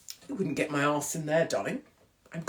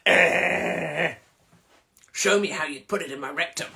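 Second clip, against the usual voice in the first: mock creepy groan; 4 dB louder than usual.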